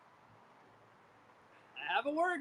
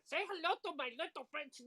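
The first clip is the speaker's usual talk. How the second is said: imitating a bad Tony Montana Cuban accent, pitched higher; bad Al Pacino accent; 8 semitones higher, 3 dB quieter than usual. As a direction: parody the movie line in a hammed-up Cuban accent. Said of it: "It was a bad accent".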